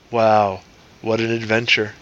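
low, flat-voiced "Wow, what an adventure"; deadpan unimpressed. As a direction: sound utterly unenthused. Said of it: "With exactly that affect".

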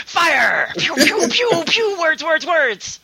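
high pew-pew gun noises; gun sound effects. Pretend. Mocking a word gun.

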